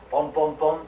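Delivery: loud spoken knock-knock; knocking sound. Acted. Caperucita knocks at the wolf's door, loud knocking.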